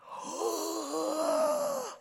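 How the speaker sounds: high hammed-up gasp of joy; exaggerated delighted gasp. Shown as a demonstration. Pastor overacts a greeting gasp, high and hammed-up. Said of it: "that's a little freaky".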